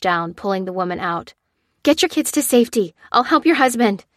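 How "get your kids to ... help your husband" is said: raised urgent shout. Acted. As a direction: call it out, higher and loud. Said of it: "shouted Lucy".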